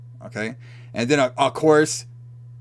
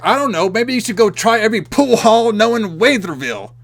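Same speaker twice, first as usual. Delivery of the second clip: higher, louder mock snark; sarcastic retort; 8 semitones higher, 7 dB louder than usual. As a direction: fling it back sarcastically. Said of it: "that was another great line".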